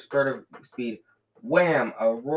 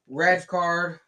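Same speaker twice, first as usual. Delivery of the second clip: yelling; 7 semitones higher, 5 dB louder than usual. A narrator's reading. Dean shouting at the ref.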